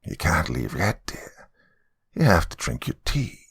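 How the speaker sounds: put-on sweet old lady turned demon; demonic old woman. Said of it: "to one of a demonic person".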